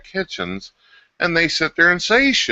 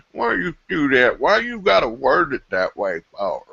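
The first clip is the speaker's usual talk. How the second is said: whiny mimicry; 5 semitones higher than usual. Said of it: "all the moaning and groaning".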